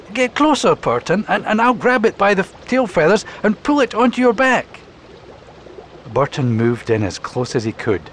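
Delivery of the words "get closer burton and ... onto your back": high, urgent tortoise voice; Torquil the tortoise. Acted. Tortoise asking Burton to get closer, high and urgent. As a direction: hurry to grab the bird.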